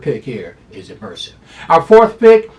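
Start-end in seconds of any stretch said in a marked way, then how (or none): 1.7-2.5 high, loud hyped-up call; excited hype. Real, not acted. Handicapper hyping his second pick, loud and high. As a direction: sell the pick like a showman.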